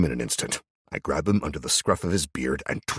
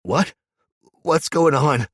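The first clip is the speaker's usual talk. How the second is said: panicked question; 12 semitones higher, 8 dB louder, slower than usual. Acted. Cameron panicking.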